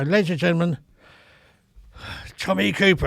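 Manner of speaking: a compère calling the act on again, lightly announced; compère announcement. Retold or imitated. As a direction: introduce the act off stage on the mic. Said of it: "I go".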